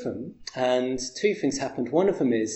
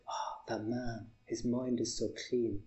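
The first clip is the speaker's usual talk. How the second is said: put-on slow, soft, lightly done Hungarian accent; a hint of a Hungarian accent, slowed down; 10 dB quieter, slower than usual. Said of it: "I'm crap at accents".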